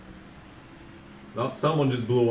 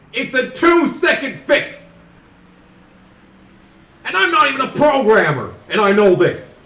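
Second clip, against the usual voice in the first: exasperated rant; 8 semitones higher, 8 dB louder than usual. Real, not acted. Ranting about lazy game fix.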